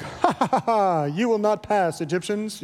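mock heroic Moses. Heroic Moses mocking at Red Sea.

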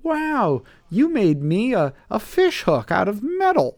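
high, swooping fake awe; mock amazement. Teacher mocking praise of human inventions, hammed-up amazement. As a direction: gush in an exaggerated high pitch, as if dazzled.

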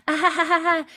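high-pitched imitated child's "ah-ha-ha"; string of high little-kid cries, acted; Jemmy. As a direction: mimic a small child's voice trailing away. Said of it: "you can hear him, like".

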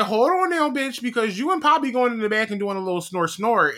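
high, sassy scolding of the character; telling off a TV character, mock sass; sassy call-out. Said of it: "I was like".

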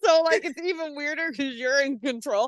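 high, giggling through the words; laughing. Real, not acted. Friend can't stop laughing at the baby image. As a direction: keep breaking into laughs.

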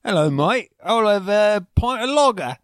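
loud hammed-up British pub order; fake British accent. American pretending to be English in a London pub, loud mock British accent. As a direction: shout it like a cartoon Englishman. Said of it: "I'm just like".

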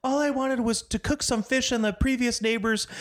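high mock-frantic neighbor rambling. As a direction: rattle it off like a frazzled new neighbor. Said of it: "she's just like".

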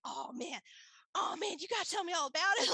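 high, eager imitation of a friend; building from eager to loud, laughing excitement; retold excitement. Friend thrilled for her, imitated high excitement. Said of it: "she was so excited for me".

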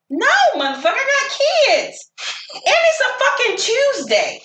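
mock fury at the pushy texter; exasperated telling-off. Woman refusing a man who wants her out on a Tuesday, mock outrage.

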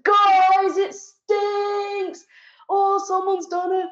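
broadcast the smell to the whole toilet; announcing girl. Retold or imitated. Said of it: "She's like".

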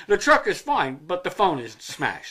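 ordinary delivery

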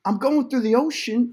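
high-pitched Wahlberg attempt; Mark Wahlberg. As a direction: try a shaky-footed movie star impersonation, high. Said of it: "I can't do a Mark Wahlberg".

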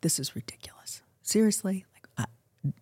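hushed, breathy complaint to God; under-the-breath plea at a red light; whispered prayer. Retold or imitated. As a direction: whisper it, fed up and exhausted. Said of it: "mumbling to God".

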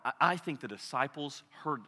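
usual speaking voice

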